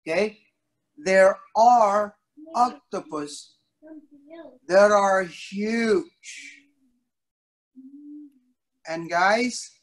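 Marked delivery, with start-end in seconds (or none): 1.0-6.6 slow, stretched-out huge; drawn-out emphasis. Real, not acted. Storyteller stretching words slowly to wow the kids. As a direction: stretch the words out to show size. Stressing how huge octopus get.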